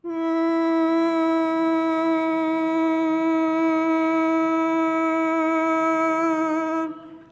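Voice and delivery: loud sustained hummed yawn into laughter; long yawn-hum. Real, not acted. Teacher yawning into a hum for the group. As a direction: yawn big into the crown, then laugh.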